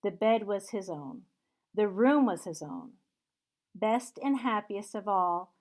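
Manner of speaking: ordinary delivery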